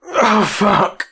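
high, loud choked gasp of pleasure; overwhelmed, choked exclamation; choked cry. A narrator's reading. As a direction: cry it out, catching in the throat. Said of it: "again with a choked".

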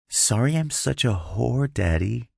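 teasing new tone. A narrator's reading. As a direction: play it coy and naughty. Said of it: "in a tone he'd never heard before".

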